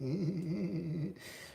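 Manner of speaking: high, creepy drawn-out titter; put-on menacing snicker; imitated sinister laugh. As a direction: reluctantly mimic his eerie giggle. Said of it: "that's what he did to".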